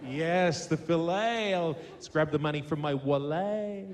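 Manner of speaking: "fillet" and "wallet" both ending in a long AY; French-style pronunciation. Retold or imitated. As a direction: mockingly say "fillet" as fill-AY, "wallet" as wall-AY. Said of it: "a little bit of a French sort of style".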